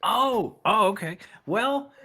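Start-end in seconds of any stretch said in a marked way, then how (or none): 1.5-1.9 put-on booming kids show opener. Announcing himself to the show.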